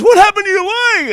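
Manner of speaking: put-on high, loud alarmed kid voice; shocked child. Little general asking what happened to the leg. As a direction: ask it like a frightened little boy. Boy asks about the leg.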